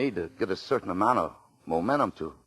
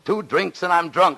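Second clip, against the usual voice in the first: sarcastic retort; 11 semitones higher, 7 dB louder, slower than usual. Acted. Bitter retort to wife.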